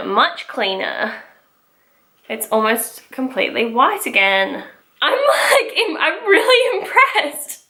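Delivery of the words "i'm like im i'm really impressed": high, laughing amazement. Real, not acted. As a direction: laugh through the words. Laughing, impressed by result.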